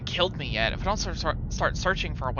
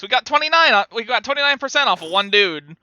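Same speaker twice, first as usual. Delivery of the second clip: excited; 5 semitones higher, 7 dB louder than usual. Real, not acted. Excited about score.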